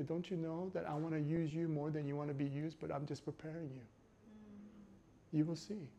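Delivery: quiet fatherly promise; God's gentle voice. Retold or imitated. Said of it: "God says to you".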